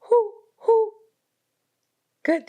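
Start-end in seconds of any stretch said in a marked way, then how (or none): 0.0-0.9 high hooting owl call. Shown as a demonstration. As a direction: hoot like an owl for the kids. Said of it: "Can you make an owl sound?".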